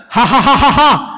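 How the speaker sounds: loud, high, exaggerated fake laughter; the guest speaker in the front row laughing on cue; hammed-up forced laugh. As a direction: overdo the laugh for the cameras.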